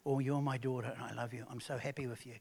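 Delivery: a quiet, tender God the Father; tender father's voice. Acted. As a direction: say it softly, like a father reassuring his child. We get to hear God the Father say.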